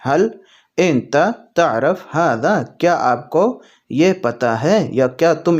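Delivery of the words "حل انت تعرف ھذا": very slow, deliberate phrase; slow Arabic demo. Shown as a demonstration. Teacher modelling the Arabic question slowly for viewers. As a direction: lay out "حل انت تعرف ھذا" slowly for learners.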